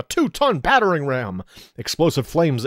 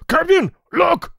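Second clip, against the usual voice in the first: very high, loud, slow call; surprised guard shout; 5 dB louder, slower than usual. Acted. Goblin guards calling to their chief. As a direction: shout out in alarm.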